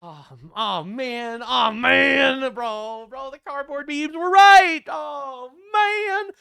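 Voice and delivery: gleeful shouting at good news; excited disbelief. Real, not acted. Streamer gloating to chat, loud and gleeful.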